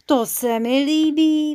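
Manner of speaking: high, loud, drawn-out delight; excited little character, slow and loud; jumping-up delight. A narrator's reading. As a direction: leap in with eager approval, stretching it out. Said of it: "vyskočil Žilka Pilka".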